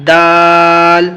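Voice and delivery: دال very slowly drawn out; letter demo. Shown as a demonstration. Teacher sounding دال for students, slow. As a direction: stretch دال long.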